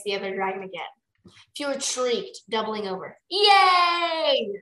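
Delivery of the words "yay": loud, high crowd cheer; drawn-out jubilant yay; shouted cheer. A narrator's reading. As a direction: cheer like a whole stadium. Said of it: "shouted the crowd".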